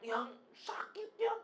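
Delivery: thin, high-pitched moaning, kept quiet; softly acted high whine of pain; wife in labour pain. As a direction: mimic a woman whimpering through contractions.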